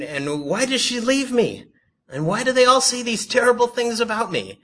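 high, loud put-on lament; mock whining. People complaining to God, high mock whine. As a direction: pile up the grievances in mock despair.

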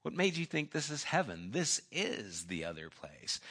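low, hushed villain's twist, lightly acted; quiet sinister reveal. Pip reveals where Rocky really is, hushed and ominous. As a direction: let it sink toward a whisper at the end.

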